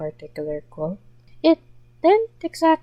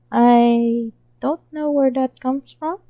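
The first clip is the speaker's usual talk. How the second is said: flustered; 5 semitones higher, 4 dB louder than usual. Acted. Chuna admits confusion.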